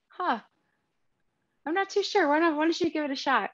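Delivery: ordinary delivery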